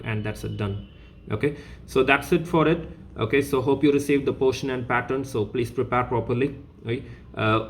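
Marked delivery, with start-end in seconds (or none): none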